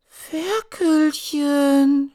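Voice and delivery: very high, drawn-out wail for the piglet; a little girl's long shrill wail; wailing cry. Acted. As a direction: cry out in despair for her pet. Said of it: "schrie sie".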